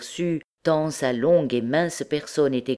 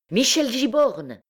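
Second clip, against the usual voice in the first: high, sharp call of a name; impatient cry; 10 semitones higher than usual. A narrator's reading. Impatient tall blond man rousing Jupiter, crying out. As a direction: cry it right under his nose. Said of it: "lui cria presque sous le nez".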